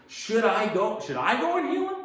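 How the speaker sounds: high, loud question climbing on "heal him"; asking. Acted. Jesus asking to verify the centurion's faith, emphatic questioning. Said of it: "So Jesus asking".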